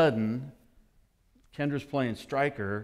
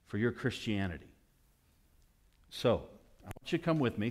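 normal talking voice

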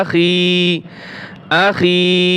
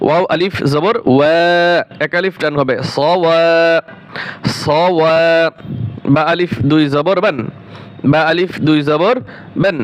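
ordinary talk, nothing marked

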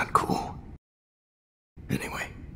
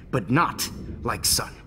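ordinary talk

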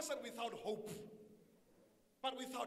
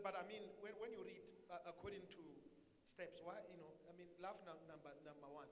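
a hint of a mumbling, unsure student, much softer; mocking a confused student; 5 semitones lower, 12 dB quieter, much faster than usual. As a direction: lightly mimic a hesitant objector, trailing off. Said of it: "He said".

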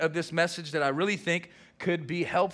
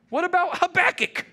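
much higher and louder, quick and eager; eager disciples; 14 semitones higher, 6 dB louder than usual. Retold or imitated. Disciples quizzing Jesus, high and eager. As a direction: keep pushing with the next question. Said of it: "And they go".

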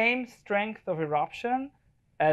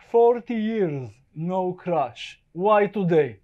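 put-on Russian accent; 7 dB louder, much slower than usual. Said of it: "he's telling me".

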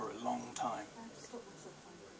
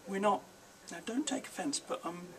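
put-on hesitant boy; 7 semitones higher than usual. A narrator's reading.

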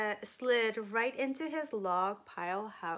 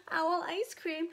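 higher, startled echo of the words; alarmed owl; 6 semitones higher than usual. Acted. Owl hears it is on the menu, alarmed. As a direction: repeat it in storybook alarm.

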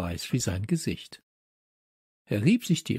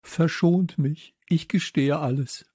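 raised-pitch begging; frightened pleading; 6 semitones higher than usual. A narrator's reading. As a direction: plead anxiously to be spared. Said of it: "rief der Mann". (ängstliches Flehen mit hoher Stimme)

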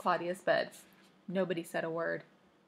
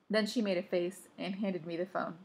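usual speaking voice

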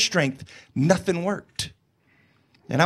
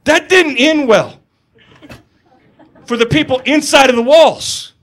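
wry retort; 5 semitones higher, 7 dB louder than usual. Retold or imitated. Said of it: "I'm like".

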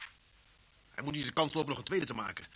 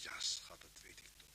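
soft whisper, tender and close; whispered comfort; 7 semitones lower, 15 dB quieter than usual. Acted. Husband whispers comfort to his shaken wife, tender hush. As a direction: hush her gently, almost no voice.

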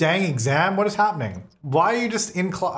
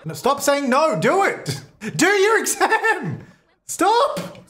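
laughing yell; 11 semitones higher, slower than usual. Real, not acted. Player laughing and yelling at her sim to do the exam.

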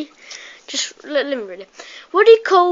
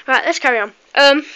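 usual speaking voice

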